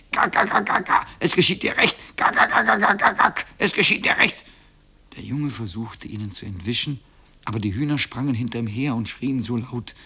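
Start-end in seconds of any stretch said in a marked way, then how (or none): 0.1-4.4 high, rapid-fire cackling taunt; shrieking hens. A narrator's reading. Hens surrounding the boy, screeching and cackling. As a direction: cackle the words like a flock of hens. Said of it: "pflanzte sich um ihn herum auf und schrie".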